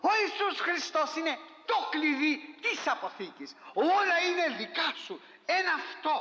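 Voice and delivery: high, ringing preacher's proclamation; preaching shout. Real, not acted. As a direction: lift it up and cry it out to the church.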